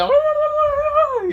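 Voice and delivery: loud, very high gobbling scream; turkey scream. Acted. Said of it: "He's just right now screaming".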